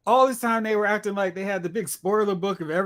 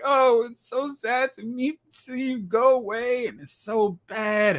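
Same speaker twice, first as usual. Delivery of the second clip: higher, slow mock-weepy whine; mock bawling; 6 semitones higher, slower than usual. Characters sobbing at the farewell, mock weepy. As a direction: ham up the tearful goodbye. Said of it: "Everyone's bawling like".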